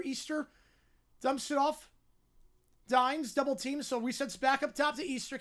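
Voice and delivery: brisk, projected basketball commentary; live play-by-play. Real, not acted. Calling a basketball possession for listeners, live play-by-play. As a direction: follow the ball down the court, punching key words.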